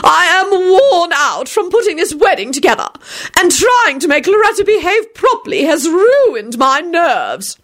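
high, overwrought society lady; slow, high-pitched histrionic complaint; dramatic shrieking. A narrator's reading. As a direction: wail theatrically, stretching the key words. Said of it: "Lady Fanshawe shrieked dramatically".

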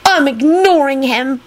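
high-pitched, haughty announcement; lofty little voice, pitched high. A narrator's reading. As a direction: inform them from a great height. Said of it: "Teddy informed the rest of the group loftily".

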